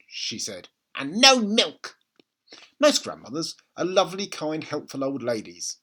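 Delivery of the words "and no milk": put-on slow, higher, louder old woman's command; bossy grandma. A narrator's reading. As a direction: lay down the rule slowly. Grandma voiced in read story.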